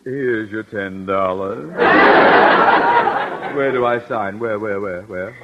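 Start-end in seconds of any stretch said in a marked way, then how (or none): 1.7-3.5 giddy laugh. Acted. Conklin's nervous giddy laugh.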